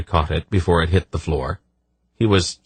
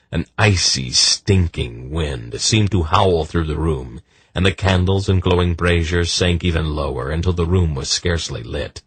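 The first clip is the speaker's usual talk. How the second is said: plain reading voice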